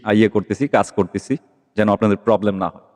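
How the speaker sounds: ordinary talk, nothing marked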